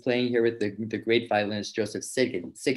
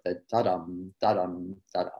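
"da-dum" sung with a short accented first note and a long unaccented second; da-dum rhythm; 3 semitones lower than usual. Shown as a demonstration. Teacher demonstrating the Hungarian rhythm, short accent then long note. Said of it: "a short note with an accent and a long unaccented note".

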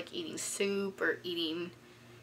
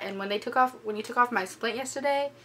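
normal talking voice

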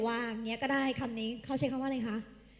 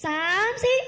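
higher, louder count-in; count-in call; 6 semitones higher, 7 dB louder than usual. Acted. Teacher counting the class in, high and loud.

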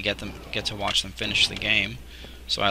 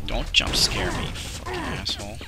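startled high yelp at a jump scare; scared horror game streamer; 6 semitones higher than usual. Real, not acted. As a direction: curse out the monster after a fright.

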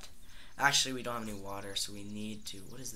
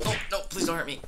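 higher, louder mock pleading; terrified horror victim; 6 semitones higher, 9 dB louder, much faster than usual. Pleading with an attacker in game.

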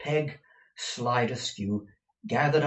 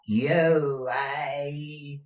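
put-on slow, drawn-out, raised old-crone voice; deaf old woman; 6 semitones higher, 5 dB louder than usual. A narrator's reading. Deaf housekeeper answering her master, slow old-woman drone. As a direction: draw out each word like one hard of hearing.

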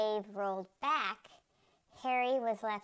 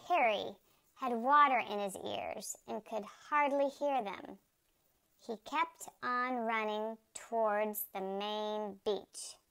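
plain reading voice

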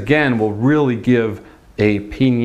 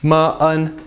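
ordinary talk, nothing marked

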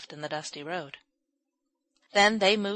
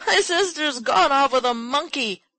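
high, loud, urgent explanation; agitated boy's high yell; loud distressed outburst; 8 semitones higher, 8 dB louder than usual. A narrator's reading. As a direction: blurt it out loudly, all upset. Distraught boy explains to testy gentleman.

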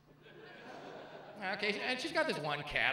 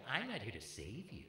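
softer, lower mock-serious girlfriend voice; a hint of a preachy self-help girl; mimicking girlfriend; 7 semitones lower, 10 dB quieter than usual. Comic mimicking young women lecturing a man, lightly mocking. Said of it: "they'll tell you".

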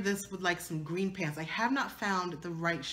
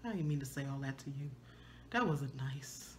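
sheepish apology; 6 semitones lower, 10 dB quieter than usual. Retold or imitated. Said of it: "I said".

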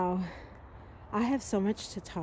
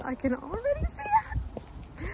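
excited squeal. Real, not acted. Fan sees the film house, squealing with excitement. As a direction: voice shooting up into a squeal.

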